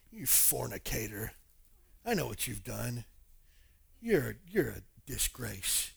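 low, slow, disapproving judge; mock condemnation. Imagined Jesus shaming woman at the well, condemning. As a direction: pronounce it slowly and low, hammed-up scorn.

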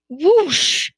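high, loud chicken shriek; shrieked whoosh. A narrator's reading. Chicken jolted by the medicine, shrieking. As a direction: let out a sudden loud "whoosh". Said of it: "shrieked the chick".

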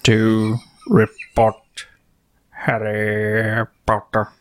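exaggeratedly drawn-out words, long pauses; extremely slow impression. Podcaster imitating a teacher addressing students, painfully slow. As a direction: stretch each word and wait between phrases. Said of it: "You gotta go even slower".

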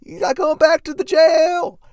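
very high, very loud slow shout; mock shriek. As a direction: scream it like a hysterical lover. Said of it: "He's like".

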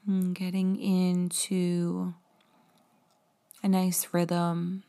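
slow, gentle meditation voice; guided meditation. Acted. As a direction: guide the breathing calmly and slowly. Leads a guided breathing meditation.

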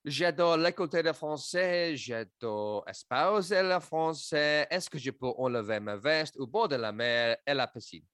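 showy schoolboy French; hammed-up French phrases; mock French. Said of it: "French impression".

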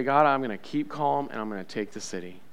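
soft, low declaration to God; hushed, low-pitched resolve; calm resolve. Shown as a demonstration. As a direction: pray it quietly and steadily. Models a calm prayer for congregation.

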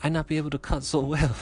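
high-pitched mock Japanese accent; bad Japanese accent. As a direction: put on a clumsy Japanese chef accent. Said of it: "Why am I doing this accent?".

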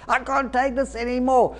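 high, subdued mock scream; strained cry to God, held back in volume; retold scream. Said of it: "I scream to God".

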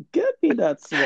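pitched well up, imitating the guy; high imitation. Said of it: "I imagine that guy's like".

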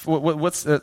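ordinary talk, nothing marked

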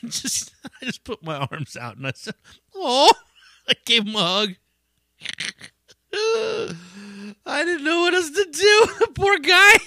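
high-pitched, laughing through the words; real helpless laughter. Real, not acted. Comic retelling how he hugged his griping friend, cracking up. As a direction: crack up mid-story, voice shooting up high.

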